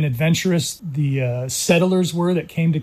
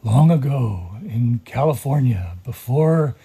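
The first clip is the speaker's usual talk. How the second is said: low, slow mock trailer narrator; movie trailer voice; 3 semitones lower, slower than usual. Guest imitating the educator at his son's assembly, movie trailer voice. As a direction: imitate a trailer voice-over, stretching the words out. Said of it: "movie trailer voice and was like".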